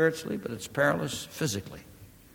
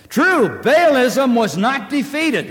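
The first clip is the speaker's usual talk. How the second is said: high, loud pulpit voice; full-force preaching; 9 semitones higher, 10 dB louder, slower than usual. Real, not acted. Preacher urging the congregation toward repentance, full force. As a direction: preach it out, driving hard.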